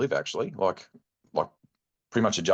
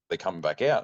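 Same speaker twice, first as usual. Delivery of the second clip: ordinary talk, nothing marked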